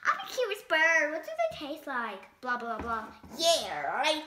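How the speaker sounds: lively picture-book read-aloud; animated storytime reading; reading to children. A narrator's reading. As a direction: read it to a room of kids.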